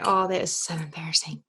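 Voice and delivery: low, whispered self-reproach; embarrassed whisper. Retold or imitated. Guest recalling stuttering on the call, embarrassed whisper. Retold embarrassed thought to herself.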